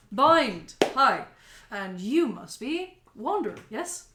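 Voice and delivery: loud, bright welcoming host; cheerful mayor voice. Acted. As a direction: greet them like an eager politician.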